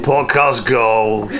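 slow, drawn-out relish; savouring slowly. Pretend. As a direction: stretch each word out grandly. Savouring the phrase.

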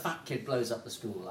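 ordinary delivery

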